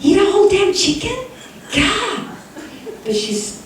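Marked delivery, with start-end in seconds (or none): 0.0-2.3 high, exasperated mock outrage; mock indignation. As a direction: scoff at cousin Gigi in disbelief. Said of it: "I was a little angry at that point".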